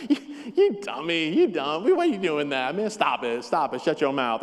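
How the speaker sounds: playful, laughing teasing. Shown as a demonstration. Pastor showing the friendly way to say it, laughing tease. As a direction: rib a friend fondly through laughter. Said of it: "tone changes everything".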